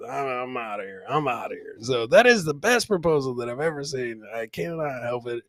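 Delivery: ordinary delivery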